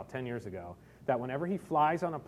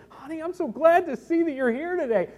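high, gushing imitation of a pastor's wife; Southern pastor's wife; 11 semitones higher, 5 dB louder than usual. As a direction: imitate her delighted welcome in a raised voice. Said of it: "I can't do the accent with a woman's tone".

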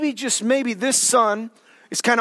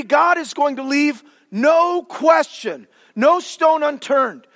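normal talking voice

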